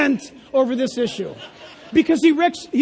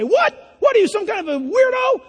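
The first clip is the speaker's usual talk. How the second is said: mock incredulous jeer; 8 semitones higher than usual. Peer mocking the one who waits, incredulous. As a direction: scoff at him like a weirdo.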